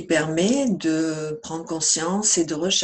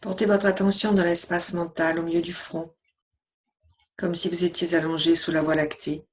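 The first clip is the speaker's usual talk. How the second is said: even, measured meditation voice with long pauses; guided meditation. Acted. Yoga teacher guiding listeners lying down through yoga nidra, calm meditation voice.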